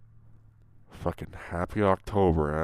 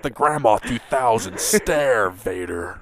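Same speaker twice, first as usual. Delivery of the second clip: slow, loud announcer voice through laughter; ring announcer; 4 semitones higher, 7 dB louder, much slower than usual. Pretend. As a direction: stretch it out like a fight announcer. Mock wrestling promo.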